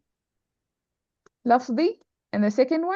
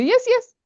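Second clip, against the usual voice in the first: "Yes, yes" pitched way up; emphatic agreement; 13 semitones higher, 4 dB louder than usual. Shown as a demonstration. Teacher gives an example of repeated words, a high emphatic "Yes, yes". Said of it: "we say".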